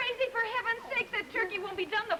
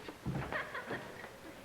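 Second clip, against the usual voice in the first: soft breathy laugh; 11 semitones higher, 9 dB quieter than usual. Acted. Wife laughs off the tension.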